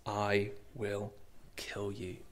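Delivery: very soft, low menace; ultimate threat. Acted. Pharaoh making his final threat to Moses, quietly menacing. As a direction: drop the voice and space out each word. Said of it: "this is the ultimate threat".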